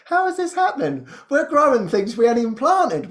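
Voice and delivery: high, baffled wonder; retold amazement. Gardeners finding things they hadn't planted, retold in high amazement. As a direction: marvel at it, pitched well up.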